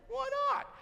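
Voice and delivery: high, eager why not; host eagerly agreeing to pay for dinner. Retold or imitated. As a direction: jump at the chance. Eager offer to Perry.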